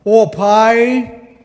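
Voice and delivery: mock the artificial prayer tone; a touch of churchy solemnity; forced prayer voice. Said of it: "E até entonamos a voz".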